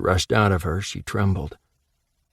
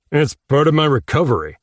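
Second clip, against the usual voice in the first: retort; 6 semitones higher, 4 dB louder than usual. Acted. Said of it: "Blake retorted".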